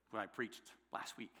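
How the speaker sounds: ordinary talk, nothing marked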